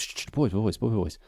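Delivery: quiet them down with a soft shush; hushing. Retold or imitated. Alcibiades hushing the ambassadors, soft mock shush.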